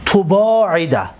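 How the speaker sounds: تباعد drawn out, the first syllable with u: tu-baa-i-da; slow passive form; slow model word. Shown as a demonstration. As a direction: dictate تباعد slowly with the u sound. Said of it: "ضمه".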